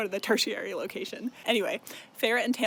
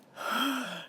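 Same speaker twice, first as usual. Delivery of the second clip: big put-on gasp; mock gasp; 3 semitones higher, 3 dB quieter than usual. Narrator reacting to the name Rysand, mock gasp. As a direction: gasp as if at a scandalous reveal.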